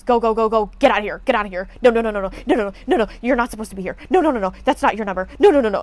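loud, rapid-fire mock panic; freaking out. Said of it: "and started, like, freaking out".

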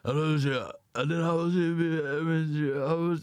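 slow, lightly slurred rocker impression. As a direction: stretch it out in a rough stab at his accent. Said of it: "that's my best [name]".

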